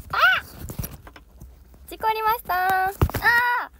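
sudden real panic shout; startled cry. Real, not acted. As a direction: yelp as the camera crashes. Camera falls during stream.

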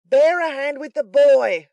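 high, very loud drawn-out yell; furious shout. A narrator's reading. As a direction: holler it furiously to your partner. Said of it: "cried Sikes, beckoning furiously to his confederate".

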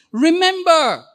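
proclaiming God's command. Pretend. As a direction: call out the command with authority. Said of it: "he says".

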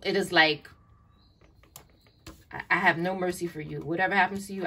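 ordinary delivery

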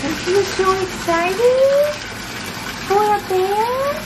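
high slow cooing voice. Real, not acted. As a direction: coo sweetly at the pup. Cooing to the dog.

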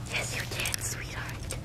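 soft unvoiced whisper to the dog; hushed doting whisper. Real, not acted. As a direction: breathe it tenderly to her.

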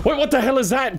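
high, loud startled yell; startled shout. Real, not acted. Gamer startled by a sudden monster, shouting in fright. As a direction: jump out of your skin and shout it.

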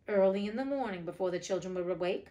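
usual speaking voice

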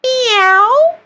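very high, rising cat meow; playful cat meow. Pretend. Coach asks Pin the cat, answers in a high meow. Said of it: "What do you think, Pin?".

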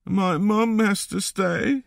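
put-on slow, high child's voice; small boy close to tears; high, drawn-out upset child; sad little boy. A narrator's reading. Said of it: "said the boy".

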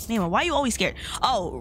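ordinary talk, nothing marked

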